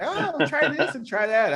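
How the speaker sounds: high, slow, egging-on voice; drawn-out coaxing, pushing food on him; eager coaxing. Retold or imitated.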